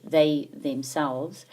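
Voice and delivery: slow, drawn-out They themselves; slow example reading. Shown as a demonstration. Teacher reading an example to the class, slowly. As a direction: lay out They themselves word by word. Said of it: "then we know it's emphatic".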